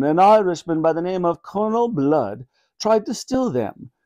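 regal voice, starting high then settling; a monarch recounting history; storytelling Queen. Acted. Queen telling the thieves about Colonel Blood, regally.

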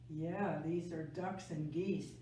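usual speaking voice

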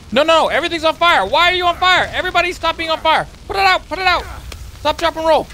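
very high, frantic yelling; comic panic. Real, not acted. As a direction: cry out in mounting alarm as the fire spreads. Everything catches fire in game.

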